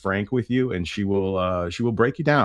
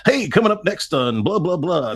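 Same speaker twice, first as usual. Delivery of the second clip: a hint of a hyped rock radio DJ, higher and louder; 10 semitones higher, 4 dB louder than usual. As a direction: toss off a mock on-air tease, lightly hammed. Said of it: "That wasn't my style".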